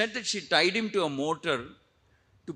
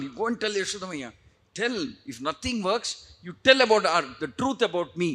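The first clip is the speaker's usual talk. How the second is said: higher, insistent voice with bursts of volume; frustrated urging; 3 semitones higher, 6 dB louder than usual. Acted. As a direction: push them to go and tell. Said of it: "I'm getting frustrated".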